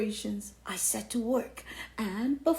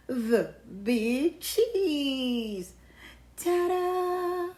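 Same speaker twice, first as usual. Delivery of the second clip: grand drawn-out announcement; 5 semitones higher, 3 dB louder, much slower than usual. Acted.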